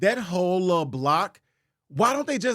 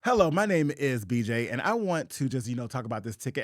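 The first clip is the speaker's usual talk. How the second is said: code-switched polite voice; 6 semitones lower, 4 dB quieter than usual. Retold or imitated. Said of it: "I code switched so good".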